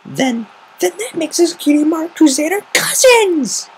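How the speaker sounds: high, loud, thrilled filly squeal; a little girl bursting with joy; excited Sweetie Belle. Acted.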